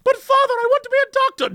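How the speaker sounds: put-on very high, fast whiny child; mock child protesting; pleading child. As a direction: beg father like a little kid. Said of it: "Is there just a certain cast of Brit".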